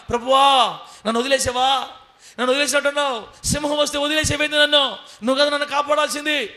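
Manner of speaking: high, loud pleading outcry; anguished cry to God. Retold or imitated. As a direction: cry out feeling abandoned by the Lord. Servant cries out to God.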